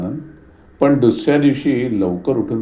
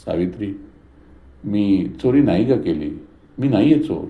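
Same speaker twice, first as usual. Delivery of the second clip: ordinary delivery, nothing marked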